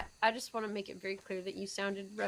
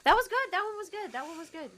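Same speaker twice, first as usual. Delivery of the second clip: excited praise; 11 semitones higher, 6 dB louder than usual. Real, not acted.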